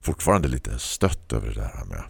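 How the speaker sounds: usual speaking voice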